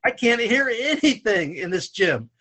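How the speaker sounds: high, slow, emphatic complaint; exasperated retold protest.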